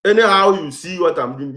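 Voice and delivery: ordinary talk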